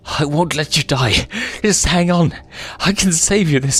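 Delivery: high, loud desperate pleading; cling on and refuse to let go; desperate cry. Acted. Son clinging to his father's claw, desperate.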